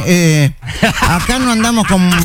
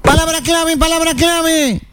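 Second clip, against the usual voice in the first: shouted catchphrase; 7 semitones higher than usual. Shown as a demonstration. Host shows the contestant how to answer, high shouted call. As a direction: sing it out high and hold the ending. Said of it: "y lo tenés que gritar".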